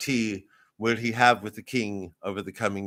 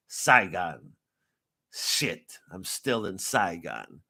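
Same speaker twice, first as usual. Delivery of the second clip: low, slow, subdued film voice-over; Martin Sheen; 4 semitones lower, much slower than usual. Acted. Director imitating the film's opening line, low slow murmur. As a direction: murmur it slowly, like a weary monologue. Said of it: "we're gonna hear Martin Sheen".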